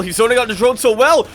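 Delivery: high, loud, fast caster yell; quick, thrilled exclamation; mounting excitement. Real, not acted. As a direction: shout the praise in rising excitement. Repeats praise with mounting excitement.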